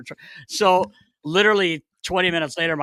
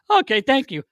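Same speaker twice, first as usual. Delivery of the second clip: high, louder satisfied reply; imitated goalie; 10 semitones higher, 5 dB louder than usual. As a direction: chirp it back as the goalie.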